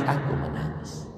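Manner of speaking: a father's tender hush; soft whisper. Acted. Prophet's words quoted tenderly. (bisikan lirih penuh haru)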